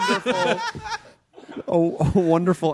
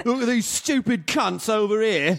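higher cheeky British yob voice; rude British lad; 7 semitones higher than usual. Pretend. Comedian nails a British lad impression for the table. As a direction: sneer it like a London lad. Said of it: "And we have a winner".

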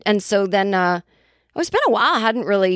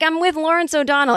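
high-pitched, hammed-up Boston accent; put-on Boston accent, pitch swooping high; 9 semitones higher than usual. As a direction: play a Boston mother gushing in accent. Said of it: "started talking in our Boston accents".

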